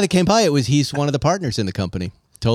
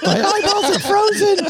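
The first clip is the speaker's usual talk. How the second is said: laughing squeal. Pretend. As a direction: squeal it while cracking up. Mock dying, laughing.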